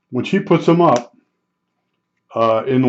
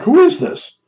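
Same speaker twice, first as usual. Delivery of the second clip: high, startled question; confused surprise. Retold or imitated. Me answering the mechanic's call, startled high question. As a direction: sound caught off guard by the call. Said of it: "I'm like".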